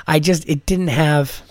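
usual speaking voice

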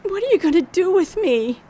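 high, loud frightened cry; terrified plea. Acted. Captive woman begging her abductor, terrified and loud. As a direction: beg him in sudden panic.

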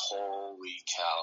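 long, drawn-out marvel; retold awe. Hunter finally seeing the big rack, slow awe. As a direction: stretch it out in slow amazement.

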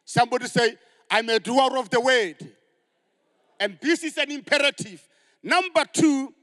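loud, high preacher's cry; full-force preaching. Acted. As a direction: preach it out to the congregation with fire.

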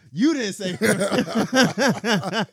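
words through high laughter; laughing. Real, not acted. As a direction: laugh it out slowly. Laughing while repeating.

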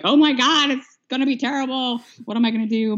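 high, mock panic; a hammed-up oh my God of alarm; panicked. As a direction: relive the new mom's dread, pitch up. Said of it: "I was like".

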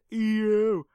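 drawn-out high yelp; loud high "ew". Pretend. Podcaster playing up reaction to a short episode, loud yelp.

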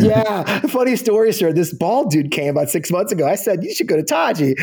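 laughing. Real, not acted. Telling Dempsey the story, laughing. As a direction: keep the laugh in the voice.

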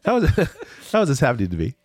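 half-laughing at his own bad luck; laughing disbelief. Traveler mocking his luck over the hallway brawl, laughing. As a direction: laugh through a fast, incredulous question.

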